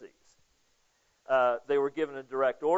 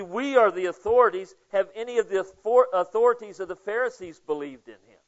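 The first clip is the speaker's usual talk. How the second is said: mocking; 3 semitones higher, 6 dB louder than usual. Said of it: "They're mocking".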